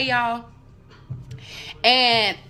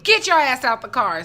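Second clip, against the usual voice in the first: raised-voice command, imitating an officer; barked police order; 4 semitones higher, 7 dB louder than usual. Officer ordering her out of the car, mildly shouted. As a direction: fire off the order loudly, playing the cop.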